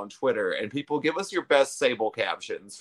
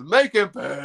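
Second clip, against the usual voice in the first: raised, louder catchphrase imitation; mock yell; 4 semitones higher, 5 dB louder than usual. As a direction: holler it like a ringside order. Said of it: "my brain just still instantly goes".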